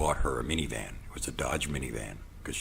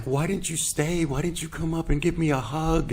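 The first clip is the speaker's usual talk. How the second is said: mimicking her high voice; 12 semitones higher, 4 dB louder than usual. To the audience, mimicking the woman asking why he left, plaintive high voice.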